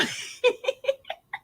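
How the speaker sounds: silent wheezing laughter; airy wheeze of laughter; breathless wheeze laugh. Real, not acted. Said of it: "I can't stop laughing".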